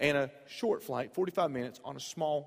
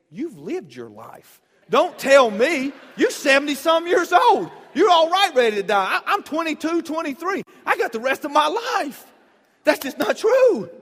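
mock outraged shout; 11 semitones higher, 13 dB louder than usual. Young man silently protesting to an old lady, shouting. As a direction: yell it back, indignant. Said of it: "in my mind, I said".

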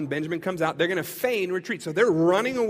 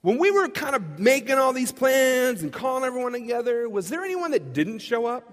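piping-up idea; 7 semitones higher, 5 dB louder than usual. Retold or imitated. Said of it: "He pipes up. He says".

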